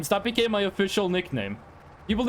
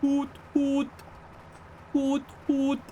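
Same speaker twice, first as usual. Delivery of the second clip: hoot, hoot in a higher owl voice; 4 semitones higher, slower than usual. Retold or imitated. Mimicking the owl outside his apartment window, hooting. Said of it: "I hear, like".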